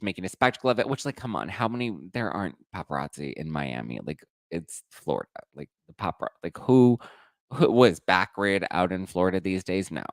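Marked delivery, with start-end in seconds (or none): none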